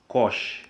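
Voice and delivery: "cosh" ending in a sh sound; pronounced "cosh". Shown as a demonstration. Teacher showing how the second function is said, "cosh" ending in sh. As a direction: say "cosh" as one syllable, closing on sh. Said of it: "pronounced as".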